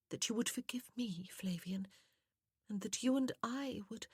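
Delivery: high, whispery pleading; soft, sweet woman. A narrator's reading. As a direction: plead sweetly, breaking off unfinished. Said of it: "how soft and sweet her voice was".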